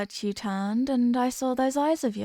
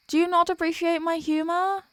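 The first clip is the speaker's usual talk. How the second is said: usual speaking voice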